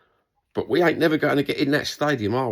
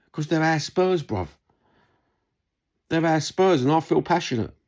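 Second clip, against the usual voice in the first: higher, fervent cry of loyalty; passionate declaration; 6 semitones higher than usual. Real, not acted. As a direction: proclaim it like a proud fan. Passionate declaration of club love.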